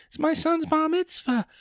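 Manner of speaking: high put-on voice of a guest; mock audience member. Comic answering the host in a high squeaky voice. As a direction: squeak it out like a nervous contestant.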